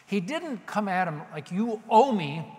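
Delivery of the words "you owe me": raised, loud, entitled tone; entitled demand. Acted. Blind man coming at Jesus like he's owed, entitled demand. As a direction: demand it as your due. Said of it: "with an attitude of entitlement".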